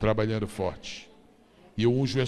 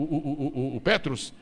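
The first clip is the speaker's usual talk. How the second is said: ordinary delivery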